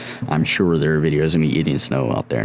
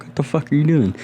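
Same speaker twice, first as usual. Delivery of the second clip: sister's exasperated demand; 9 semitones higher than usual. Retold or imitated. Sister confronts him at top.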